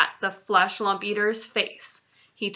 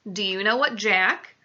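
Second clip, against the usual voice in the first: ordinary delivery, nothing marked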